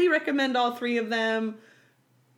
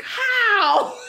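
high, loud baffled question with a laugh; mock the brother's disbelief, high and loud; incredulous how; 12 semitones higher, 8 dB louder than usual. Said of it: "And he was like".

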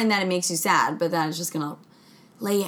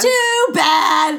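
high, loud comeback; loud, playful defiance; defiant shout; 6 semitones higher, 7 dB louder than usual. Real, not acted. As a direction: shout down the objection. Defiant reply to accent ban.

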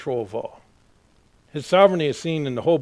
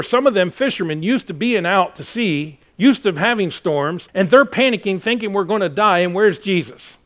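louder, higher pulpit storytelling; storm story preached; 5 semitones higher, 7 dB louder than usual. Acted. Pastor retelling the disciples' storm panic, loud preaching. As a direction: drive the story home with force.

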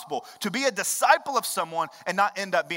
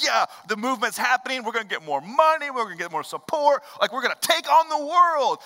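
louder, eager, climbing higher to the end; imitated excited disciples; 5 semitones higher, 5 dB louder than usual. Disciples gushing about the growing movement: excited, rising voice. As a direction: play the disciples thrilled by the crowds. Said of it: "are getting all excited".

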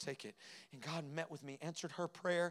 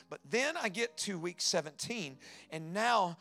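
higher and louder, building testimony; full-force preaching; 3 semitones higher, 8 dB louder than usual. Acted. Preacher confessing his grief to the congregation, full-force preaching. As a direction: preach it out, climbing with each line.

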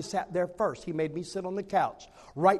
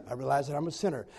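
softer, lower, faster timid repeat; quiet echo; 7 semitones lower, 7 dB quieter, faster than usual. Retold or imitated. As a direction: mumble it back after the leader. Man echoes prayer softly.

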